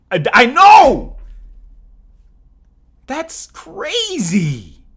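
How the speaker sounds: high, loud burst of amazement; excited shout. Real, not acted. Streamer amazed by donations, excited shout. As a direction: burst out loudly, drawing out the last word.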